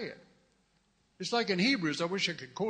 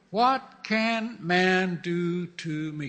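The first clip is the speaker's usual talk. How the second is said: slow defiance; 4 dB louder, much slower than usual. Acted.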